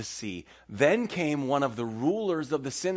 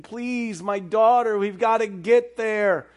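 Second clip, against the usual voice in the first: higher, louder, desperate plea; pleading Jairus; 9 semitones higher, 7 dB louder, slower than usual. Pretend. The father pleading with the crowd, desperate and loud. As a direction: beg them to hurry for your daughter. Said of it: "It's like".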